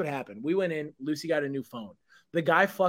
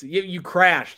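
ordinary talk

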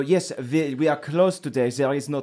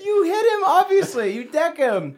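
laughing; 13 semitones higher than usual. Real, not acted. GM laughing while ruling.

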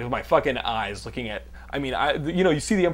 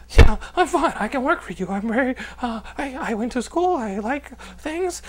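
flustered; 10 semitones higher than usual. Retold or imitated. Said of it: "And I was like".

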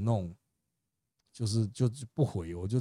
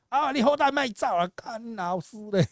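imitated scolding, pitched way up; retold angry cursing; 11 semitones higher than usual. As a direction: curse out a scammer in a hammed-up rant. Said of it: "就是把那个诈骗集团骂一顿".

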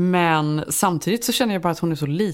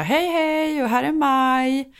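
cheery greeting; 8 semitones higher, much slower than usual. Retold or imitated. Arriving cheerfully at preschool.

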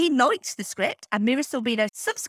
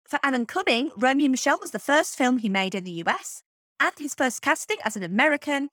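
ordinary talk

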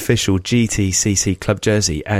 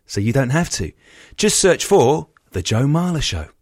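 ad read; 3 semitones higher than usual. Acted. Podcast ad read.

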